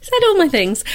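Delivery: high laughing reply. Real, not acted. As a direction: laugh through the words. Laughing at being pre-empted.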